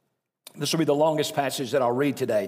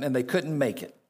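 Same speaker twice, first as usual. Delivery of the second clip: ordinary delivery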